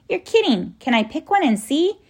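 put-on high-pitched excited little girl; eager and incredulous, voice jumping up high; amazed child. A narrator's reading. Lindy reacts to bread-scented flowers.

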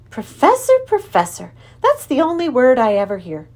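high, loud start to a mild scolding; gently admonishing. A narrator's reading. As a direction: chide them lightly with an exasperated repeat. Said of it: "she admonished gently".

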